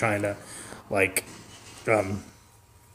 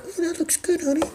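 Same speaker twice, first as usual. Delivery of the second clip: much higher, soft meek; tiny wife voice; 8 dB quieter than usual. Acted. Meek wife watching cooking show, tiny soft voice. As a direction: murmur it sweetly and timidly. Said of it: "she'll be like".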